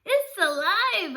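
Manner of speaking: high, loud, drawn-out cry; boy shouting to his family; excited shout. Acted. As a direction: burst in yelling the news. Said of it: "I shouted".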